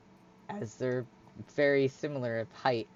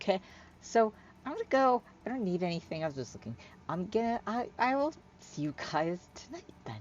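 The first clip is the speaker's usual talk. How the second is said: higher, halting, flustered voice; nervous Hyla; 8 semitones higher than usual. Acted. Hyla hurrying to leave her friends, nervous. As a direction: excuse yourself awkwardly, stumbling on words.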